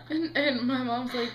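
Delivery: normal talking voice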